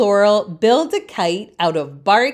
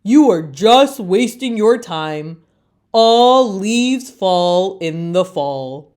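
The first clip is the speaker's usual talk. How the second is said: slow, louder mocking chorus; taunting leaves; 4 dB louder than usual. Other leaves taunt Leaf, slow and loud. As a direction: rub it in, slow and smug. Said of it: "taunt the other leaves".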